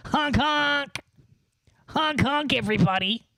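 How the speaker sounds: high goofy clown honks; silly clown. Pretend. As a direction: honk like a circus clown. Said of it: "my classic bit".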